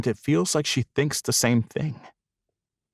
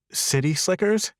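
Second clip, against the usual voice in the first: high, puzzled read of the sign; a bemused lift on the name; surprised question; 9 semitones higher than usual. A narrator's reading. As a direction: ask it in disbelief. Woman reading sign, surprised.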